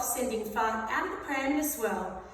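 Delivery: usual speaking voice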